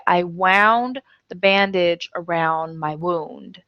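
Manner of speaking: "wound" like round, then "wound" like woond; slow, spelling out each "wound"; slow homograph demo. Shown as a demonstration. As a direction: model past-tense sentence. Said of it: "I would say".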